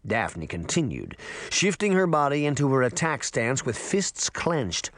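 usual speaking voice

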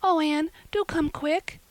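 high, loud frightened begging; frightened girl begging her friend for help; nervous pleading. A narrator's reading. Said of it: "implored Diana nervously".

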